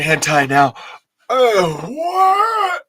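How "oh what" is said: very high startled cry, then a gasped oh my God; shocked shriek. Real, not acted. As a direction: caught off guard and yelping. Startled by the toy.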